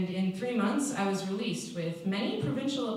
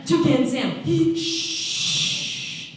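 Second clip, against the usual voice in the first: loud, higher blurt cut off by a sharp shush; blurted then shushed; 6 semitones higher, 9 dB louder, much slower than usual. Acted. Inmate blurting a forbidden nickname, then shushing.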